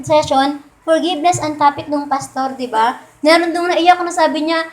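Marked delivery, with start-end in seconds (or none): none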